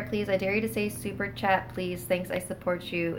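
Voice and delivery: usual speaking voice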